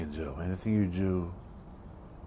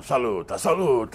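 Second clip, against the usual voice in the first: high, loud, drawn-out mock cheer; showy toast called out slowly, higher and louder; mock wedding toast; 7 semitones higher, 8 dB louder than usual. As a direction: imitate guests crying out a toast. Mimics toasting wedding guests.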